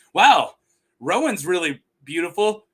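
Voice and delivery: mock dimwit's wonder; Dunk. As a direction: marvel like a simple idiot. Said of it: "Dunk talks like".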